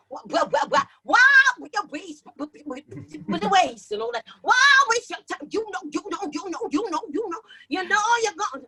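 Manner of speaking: high rhythmic stuttered hype vocal; live remix hype. Retold or imitated. Remixing her song live to the crowd, high stuttering hype chant. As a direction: chop the words like a live remix. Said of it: "and go".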